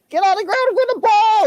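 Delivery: high, loud frantic scream. Retold or imitated. Dog owner screaming at the stranger to get down. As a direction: scream the order in panic. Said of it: "I screamed".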